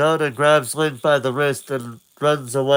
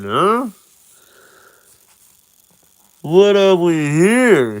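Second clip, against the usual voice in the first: slow, lightly voiced taunt; sly guy; 5 semitones higher, 3 dB louder, slower than usual. Random guy finds the emerald, slow sly voice. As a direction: drawl it slyly. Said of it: "he says".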